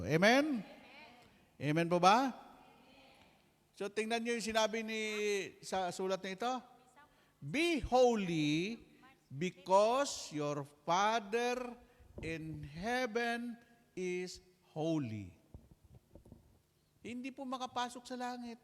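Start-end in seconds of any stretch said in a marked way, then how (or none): 0.0-2.3 loud call to the crowd; calling amen. Real, not acted. Preacher calling congregation to answer amen, loud. 7.4-15.3 slow, loud, every word weighed; scripture quote. Acted. Preacher proclaiming scripture slowly to congregation, loud and deliberate.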